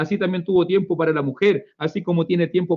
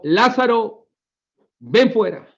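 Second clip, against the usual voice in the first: commanding call; 6 semitones higher, 3 dB louder, slower than usual. Retold or imitated. Jesus commanding Lazarus.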